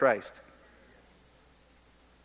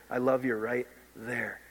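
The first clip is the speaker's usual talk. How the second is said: tender Jesus voice; 3 semitones lower, 4 dB quieter than usual. Retold or imitated.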